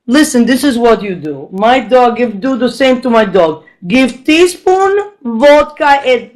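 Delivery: slow, deliberate mock Russian accent; imitated Russian neighbor giving instructions. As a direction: dispense home remedies with a thick Russian accent. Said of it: "one of my Russian neighbors".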